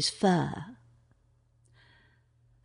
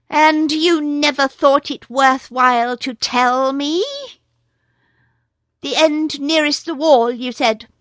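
higher, louder urgent mongoose; a brave mongoose cutting in; higher, louder mongoose asking after Nagaina; Rikki Tikki; 7 semitones higher, 5 dB louder than usual. A narrator's reading.

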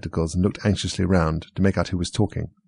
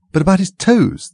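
Mock Turtle persisting; 11 semitones higher, 5 dB louder than usual. A narrator's reading. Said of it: "the Mock Turtle persisted".

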